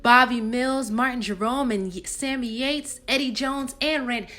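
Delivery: theatrical poem delivery, lifted pitch; performed choreopoem reading; monologue recital. Acted. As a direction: recite it as an audition piece. Rehearses an audition monologue.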